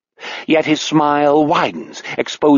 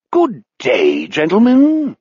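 a lightly voiced, falsely pleasant villain greeting; honeyed welcome with a high lift on gentlemen; most pleasant voice; 6 semitones higher than usual. A narrator's reading. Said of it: "in his most pleasant voice".

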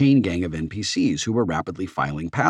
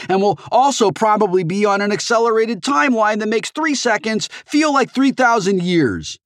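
much higher, louder panicked rant; on the verge of hysteria; 6 dB louder than usual. A narrator's reading. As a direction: spiral into frantic hysteria. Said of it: "suddenly on the verge of hysteria".

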